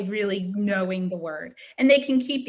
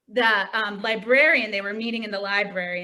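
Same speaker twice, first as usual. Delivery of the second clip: normal talking voice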